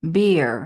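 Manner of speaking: বেয়ার loud and falling, ending in a smooth R; smooth British R. Shown as a demonstration. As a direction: model বেয়ার for pupils, gliding into the R. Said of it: "আরটাকে একটু smoothly বলতে হয়".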